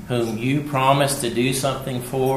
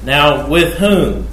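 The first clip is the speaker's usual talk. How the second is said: slow, loud scripture question; 3 semitones higher, 7 dB louder, slower than usual. Acted.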